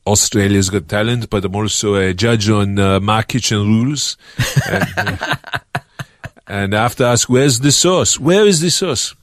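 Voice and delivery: put-on light French accent; a hint of a fussy French chef; Manu. A French chef judge demands the sauce. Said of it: "Manu here".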